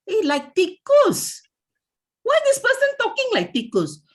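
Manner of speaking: high, loud, incredulous put-on voice; mocking boss. Boss complains about a mumbling employee, high and mocking. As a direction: squawk it in disbelief.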